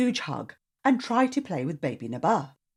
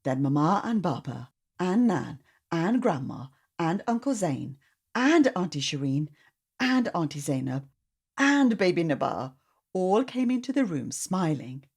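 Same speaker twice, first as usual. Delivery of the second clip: plain reading voice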